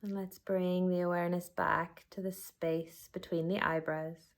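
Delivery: unhurried body-scan guidance with long pauses; guided meditation. Acted. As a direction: guide them gently inward, letting each phrase settle.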